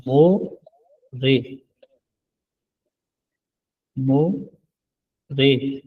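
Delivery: More stretched very slowly; drawn-out model word. Shown as a demonstration. Korean teacher stretching more slowly for students. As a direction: draw out more long and slow. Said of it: "परसों को क्या कहते हैं".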